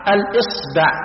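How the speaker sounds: الإصبع as al-isba', i then a; most eloquent form. Shown as a demonstration. Teacher gives the preferred form, الإصبع said al-isba'. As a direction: say الإصبع carefully with kasra, then fatha on the b. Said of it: "بكسر الهمز وفتح الباء".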